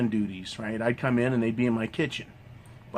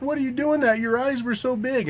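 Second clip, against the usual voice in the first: high, louder mock woman's voice; mock women's reply; 12 semitones higher, 3 dB louder than usual. Women at work reacting to him, high mocking squeal. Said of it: "They're like".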